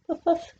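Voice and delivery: high playful puff puff; steam train puffs. Pretend. Reading to a child, playful high train puffs. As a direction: chuff it out like a toy train.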